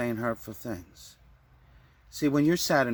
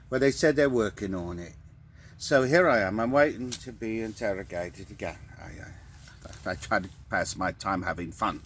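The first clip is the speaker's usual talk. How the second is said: normal talking voice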